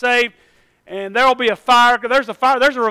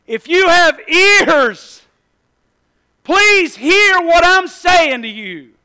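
a loud, slow call, higher in pitch; 6 semitones higher, 5 dB louder, much slower than usual. Retold or imitated. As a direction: call it out slowly to the whole crowd. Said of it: "Jesus said this really loudly".

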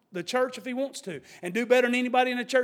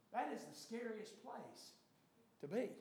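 hushed; 19 dB quieter than usual. Real, not acted. Preacher's hushed warning.

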